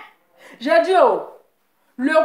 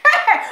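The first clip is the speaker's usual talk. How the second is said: high burst of laughter; 12 semitones higher than usual. Real, not acted. Laughing at the rival.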